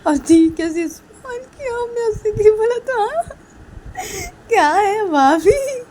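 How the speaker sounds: high, loud squeals through laughter; acted helpless giggling protest; laughing while talking. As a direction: barely get it out for laughing. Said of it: "Rahul हंसते हंसते सिया को बोलती".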